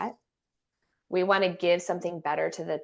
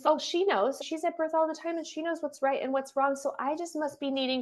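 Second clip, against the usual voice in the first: mocking inner doubt; 8 semitones higher than usual.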